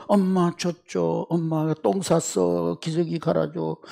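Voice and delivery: put-on soft, slow baby talk; mock babyish whining. Preacher mimicking grown believers still asking mom, in mock baby talk. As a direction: play a helpless toddler, softly and slowly. Mocking grown adults acting like babies.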